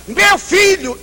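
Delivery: outraged mother defending her son; shocked cry. Retold or imitated. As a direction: cry out as if the idea is unthinkable.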